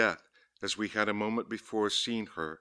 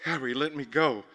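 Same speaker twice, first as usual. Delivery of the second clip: spellbound pleading; 7 semitones higher, 4 dB louder than usual. A narrator's reading.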